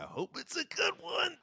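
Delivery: soft, very high sing-song voice; tiny high-pitched hopeful aside; playful falsetto. Acted. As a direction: pipe it up high and quiet. Playful handoff to co-host.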